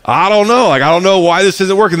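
quick, puzzled shrug of a voice; baffled disciples. Retold or imitated. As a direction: sound stumped and a bit flustered. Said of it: "the disciples are like".

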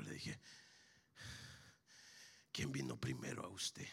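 soft, low preacher storytelling; hushed testimony. Acted. Preacher telling a father his God can heal his daughter, hushed testimony. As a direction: draw the congregation in quietly with pauses.

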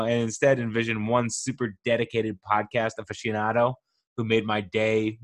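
normal talking voice

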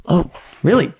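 the tough guy suddenly impressed; impressed surprise. Retold or imitated. Young vet learning he went to Ranger school, mock surprise. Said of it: "his whole demeanor changed".

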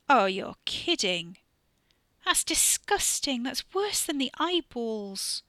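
slow, drawn-out disgust. A narrator's reading. Girl reacts to the rotten-fish burp with slow disgust. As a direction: recoil from it slowly, nose wrinkled. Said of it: "scrunched her nose up in disgust".

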